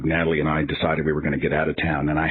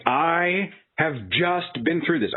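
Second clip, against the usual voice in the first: exasperated; 9 semitones higher, much slower than usual. Retold or imitated. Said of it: "I'm not screaming, but I'm like".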